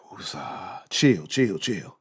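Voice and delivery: sigh, then soft, low self-soothing; calming down. Real, not acted. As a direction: talk yourself down after a rant. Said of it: "So let me chill".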